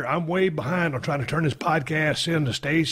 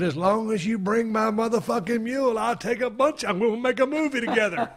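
raised, laughing-edged eager reply; excited reply; 7 semitones higher than usual. Retold or imitated. Raised eager promise to the trucker. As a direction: tell the driver he'll get pictures if he brings the mule. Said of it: "I said".

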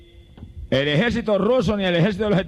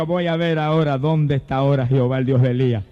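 lower, inward faith declaration; model phrase for the faithful; 5 semitones lower than usual. Shown as a demonstration. Preacher giving the troubled faithful words to say, low and steady. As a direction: say it low and steady, as a personal vow.

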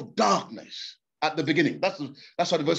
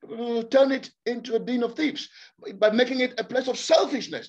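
ordinary talk

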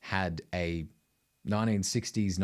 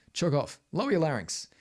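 lightly put-on, higher-pitched teacher orders; mock instructor; 8 semitones higher than usual. Singing coach parodying other teachers' strange instructions, higher mock-instructor voice. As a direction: toss out silly commands in a raised voice.

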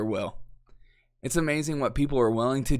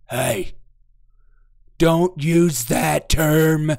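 slow, louder scolding boss voice; mock boss; 4 semitones higher, 8 dB louder, much slower than usual. Boss scolding an employee for saying man, slow and loud. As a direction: drag out the scold like a prissy boss. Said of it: "you see Anthony Blinken".

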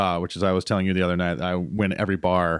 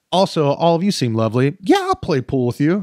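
higher, louder cheerful chatter; mock bubbly; 7 semitones higher, 4 dB louder than usual. Anna at the pool bar, mock bubbly and higher. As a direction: play the breezy friendly girl. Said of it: "she was like".

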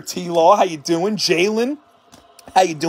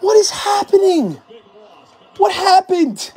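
high incredulous yelp into laughter; overwhelmed disbelief; 8 semitones higher than usual. Real, not acted. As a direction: lose it in disbelief. Overwhelmed by hits.

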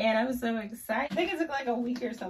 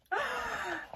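excited gasp; 13 semitones higher than usual. Real, not acted. Sees her order.

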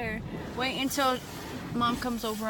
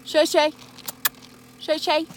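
calling out loud; 5 semitones higher, 9 dB louder than usual. Real, not acted. Owner calling the dog Shay Shay, loud high call. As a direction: call the dog's name loudly, higher.

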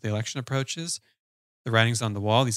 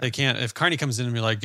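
usual speaking voice

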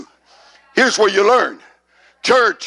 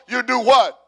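ordinary talk

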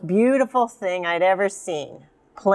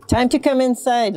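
mild higher-pitched call; 5 semitones higher, 3 dB louder than usual. A narrator's reading. Mommy calling Luna Moona inside, lightly calling out. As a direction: call the child indoors, lightly. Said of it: "Luna Moona called Mommy".

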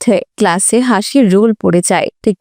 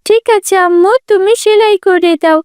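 put-on high-pitched child's voice, accepting; agreeable little daughter; 9 semitones higher than usual. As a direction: agree with mother like a little girl. (রাজি হওয়া ছোট মেয়ের উঁচু গলা)

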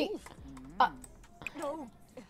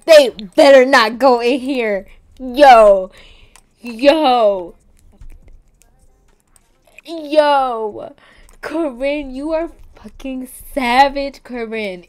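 very loud, raised-pitch outburst; shocked yelling; 4 semitones higher, 18 dB louder, slower than usual. Real, not acted. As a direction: yell at the screen in disbelief. Shocked outburst at game betrayal.

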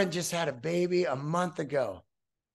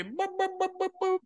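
high beat-making boops; 11 semitones higher, 4 dB quieter, faster than usual. Retold or imitated. Mimicking a beat for the song.

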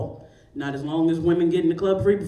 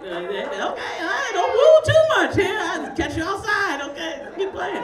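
laughing at the crowd; 12 semitones higher, 4 dB louder than usual. Real, not acted. Responding to crowd woo.

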